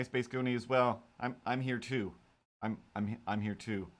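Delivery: usual speaking voice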